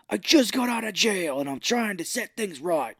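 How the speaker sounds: high, pleading character voice; mock indignant self-justifying cry; hammed-up outburst. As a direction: protest it in an exaggerated put-on voice. Acted outburst of jailed character.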